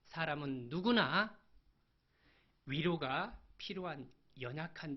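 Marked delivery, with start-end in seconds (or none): none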